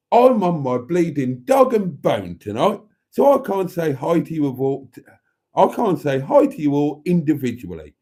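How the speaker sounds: hammed-up Cockney impression; Matron's voice. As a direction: belt it out in exaggerated Cockney. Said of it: "I should do the voice".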